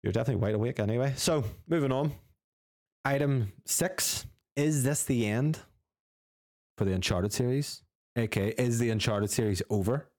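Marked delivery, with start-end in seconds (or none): 1.1-10.1 announcer voice. Acted. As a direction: introduce the next item like a host. Announces next show item.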